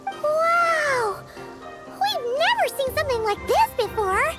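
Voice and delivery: very high, cartoonish wonder; squeaky amazement. Sidekick shown the masterpiece, squeaky amazement. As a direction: squeal it like an awestruck mascot.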